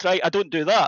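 ordinary talk

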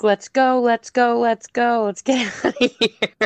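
high, urgent mock pleading; mock panicked yell. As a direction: act out begging to flee, breaking into a laugh. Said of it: "the wife is screaming like".

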